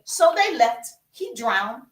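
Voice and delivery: ordinary talk, nothing marked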